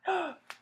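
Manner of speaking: quick startled intake of breath; sharp real gasp. Real, not acted. Reader spotting the cat eating something, startled gasp. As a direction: gasp in sudden alarm.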